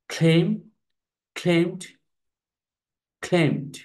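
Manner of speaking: ক্লেম, ক্লেমড, ক্লেমড said slowly and distinctly, high; clear teacher model. Shown as a demonstration. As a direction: model each form ক্লেম ক্লেমড for the class. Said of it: "উচ্চারণগুলো দেখে নাও". (শিক্ষকের স্পষ্ট উচ্চারণ)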